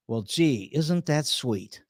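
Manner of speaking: low, soft and slow, dripping sarcasm; sarcastic. Real, not acted. Host mocking Trump's unified Reich promise, sarcastic and soft. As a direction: say it sweetly but mean the opposite.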